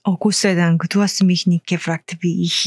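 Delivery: ordinary talk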